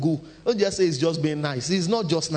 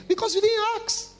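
high squeaky punchline; 13 semitones higher than usual. Real, not acted. Preacher's punchline.

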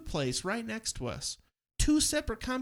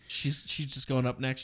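usual speaking voice

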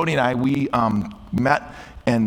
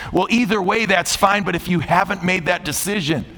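raised, high sermon delivery; impassioned preaching appeal; 6 semitones higher than usual. Real, not acted. Pastor asking listeners about their decision, impassioned preaching. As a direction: press the congregation, pitched high and sustained.

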